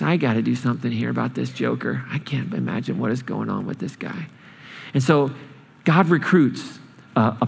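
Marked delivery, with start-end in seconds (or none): 0.0-4.3 talking under his breath about the joker; exasperated aside. Retold or imitated. God musing quietly about Jonah, low aside. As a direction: lower and softer, muttered aside. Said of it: "God says".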